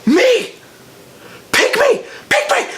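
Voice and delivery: high, eager begging cry; squeaky pick-me plea; eager kid. Retold or imitated. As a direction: beg to be chosen like a kid.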